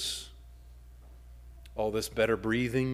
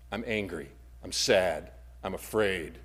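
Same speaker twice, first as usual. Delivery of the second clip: ordinary talk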